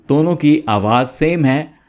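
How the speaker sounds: ordinary delivery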